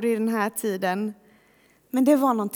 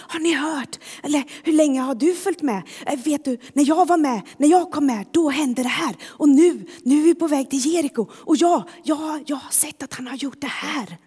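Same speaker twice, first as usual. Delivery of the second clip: imitated agitated crowd talk, slightly higher and louder; excited chatter; 3 semitones higher, 3 dB louder, faster than usual. Followers chatting on the road to Jericho, excited. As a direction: tumble through lines like eager followers swapping stories. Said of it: "lite så här upphetsat samtal".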